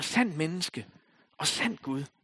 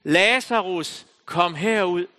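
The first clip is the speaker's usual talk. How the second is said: slow, louder call drawn out on Lazarus; preacher leading the congregation in the call, slow and loud; 5 semitones higher, 9 dB louder, slower than usual. Shown as a demonstration. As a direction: call the name out to the whole room. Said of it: "lad os bare prøve det her".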